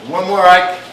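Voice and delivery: loud, high call across the room. Real, not acted. Calling out to the Marine.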